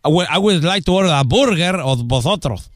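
put-on Spanish-accented English. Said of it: "el inglés lo hablo con acento de español".

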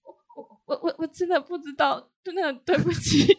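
whispered, halting stammer near tears; mock a shaky, frightened apology; trembling voice. Junior auditor apologizing to the scary manager, quivering and hushed. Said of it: "语带抖音的说".

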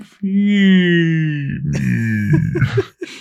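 long moan sliding down in pitch; slow drone like batteries running out; dying electronic toy. Acted. Imitating the Furby buried in the toy box, slow dying-toy drone. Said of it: "when they hear it again, the same".

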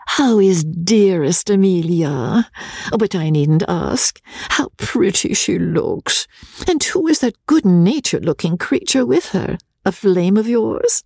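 high, gushing society lady; effusive high-pitched cordiality; cordial, teasing Rebecca. Acted. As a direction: gush sweetly in a high, put-on lady's voice.

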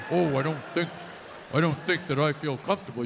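low, dopey man impression; mocking man's voice. Wife to husband, mocking his low dopey voice. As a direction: play the whiny boyfriend, low. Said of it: "You say".

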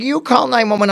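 high, loud panicky retort, retold; freaking out. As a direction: imitate a panicking girlfriend throwing it back. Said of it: "and she's freaking out".

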